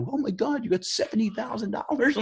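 very high squealing gasp of amazement; amazed friends. Retold or imitated. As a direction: gawk at the bank balance. Friends see his bank balance.

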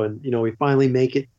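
usual speaking voice

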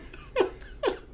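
high giggle. Real, not acted. Giddy laugh after quote.

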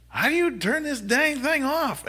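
high, slow, loud helpless shout; yelling. Retold or imitated. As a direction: call out across the lot. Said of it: "It's like".

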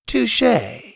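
high wry touché; wry concession. A narrator's reading. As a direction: concede with a grin. Sawyer concedes.

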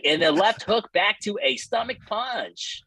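high, slow mock commentator call; commentator quote. Mocking the commentator's stomach punch call. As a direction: ham up the commentator's play-by-play. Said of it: "He's like".

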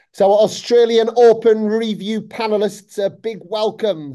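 high, loud welcome to the panel; enthusiastic host voice. Real, not acted. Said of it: "trying to give my most enthusiastic voice".

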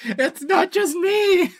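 high, slow words through laughter; laughing. Real, not acted. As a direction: talk while cracking up. Hosts cracking up after flub.

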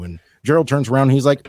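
ordinary talk, nothing marked

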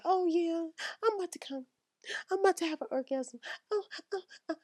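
faked orgasm. Woman faking an orgasm for her man, high and hammed-up. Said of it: "they just be like".

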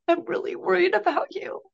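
high, choked-up voice, close to tears; tearful. Real, not acted. Mother telling her son she's worried, tearful. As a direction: hold back tears as you say it.

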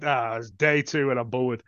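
low, slow bored guard; putting on weary boredom; mock boredom. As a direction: sigh it out like a bored security guard. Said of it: "a security guard was bored".

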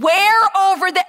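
an impatient soldier raising his voice; exasperated demand. Retold or imitated. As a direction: snap the question out in frustration. Soldier pressing, now exasperated.

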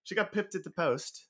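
amateur British accent. Shown as a demonstration. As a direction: have a go at sounding British. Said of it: "Can we hear your British accent?".

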